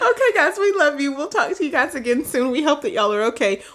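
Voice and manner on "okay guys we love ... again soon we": high, loud, through laughter; laughing sign-off. Real, not acted. Host saying goodbye to listeners, laughing. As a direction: sign off still cracking up.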